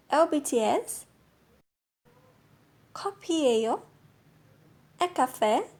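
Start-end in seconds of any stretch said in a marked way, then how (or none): 2.9-3.8 커피예요 ending on a clear rise; teacher modelling a question tune on 커피예요. Shown as a demonstration. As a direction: lift the end of 커피예요 like a question. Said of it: "mudar a sua entonação".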